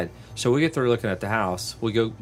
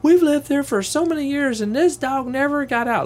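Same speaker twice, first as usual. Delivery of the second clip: much higher, louder indignant protest; indignant wife; 4 dB louder than usual. Retold or imitated. Seller's wife insisting on the phone, indignant and high. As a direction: voice the outraged seller in disbelief. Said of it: "and she's like".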